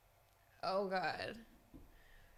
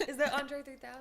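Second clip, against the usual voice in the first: laughing; 5 semitones higher, 5 dB louder than usual. Real, not acted. Guessing answer while laughing.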